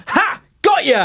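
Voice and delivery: high, loud triumphant jeer; mock gloating. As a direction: crow over beating them with your gift. Acted gloating at gift receiver.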